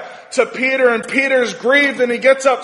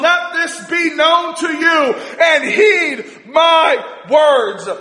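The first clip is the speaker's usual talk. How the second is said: preaching climax; 3 semitones higher than usual. Acted. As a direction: proclaim to the multitude. Preacher climaxing with Peter's speech.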